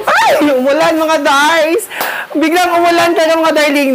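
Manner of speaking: sudden high startled cry, then fast excited chatter; surprised. Real, not acted. Vlogger startled by sudden rain, telling viewers, high surprised cry. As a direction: caught off guard by the rain, voice jumps way up.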